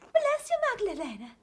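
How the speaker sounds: put-on high, small child voice. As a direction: play a little kid. Voices child greeting Magdalena.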